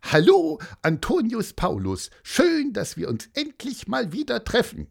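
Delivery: high-pitched, singsong happy hello; bubbly, high little voice full of joy; cheerful greeting. A narrator's reading. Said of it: "sagte fröhlich".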